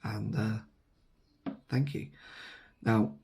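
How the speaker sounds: ordinary talk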